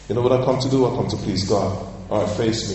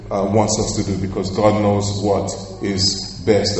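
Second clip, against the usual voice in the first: ordinary talk, nothing marked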